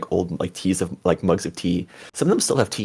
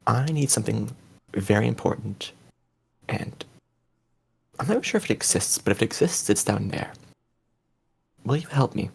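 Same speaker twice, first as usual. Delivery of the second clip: grave, measured plea for help; very serious; 2 semitones higher, 3 dB quieter than usual. Acted. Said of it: "he actually gets very serious".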